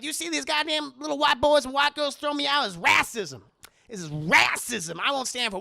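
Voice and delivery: loud mock outrage at racism; hammed-up indignant rant as Madea; angry rant. Madea thrown out, ranting.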